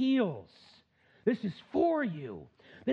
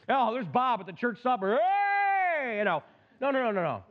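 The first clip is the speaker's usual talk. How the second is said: hammed-up greeting; 4 semitones higher than usual. Preacher mimics a member spotting Bob at church supper, hammed-up greeting. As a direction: play a churchgoer whooping a hello.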